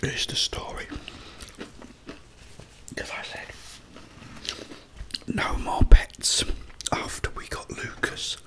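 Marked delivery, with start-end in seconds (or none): none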